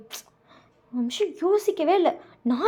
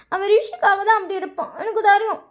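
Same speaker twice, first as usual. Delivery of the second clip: higher, stretched-out sulk; hurt whining; 6 semitones higher, 3 dB louder than usual. A narrator's reading. Sister insisting he only worries about Rishi, sulky whine. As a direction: dig in, whining the grievance.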